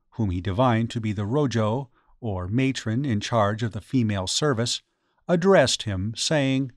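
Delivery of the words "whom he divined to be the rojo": usual speaking voice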